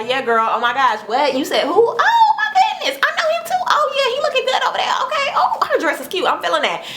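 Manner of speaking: high, giggly club chatter with a friend; gossiping girlfriend voice. Retold or imitated. To Shanice in the club, high giddy gossip. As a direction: squeal the gossip to your girl over the music.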